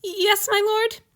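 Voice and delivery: high, slow teary answer; timid reply. A narrator's reading. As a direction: answer meekly through tears. Timid servant answers his master.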